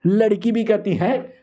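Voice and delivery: usual speaking voice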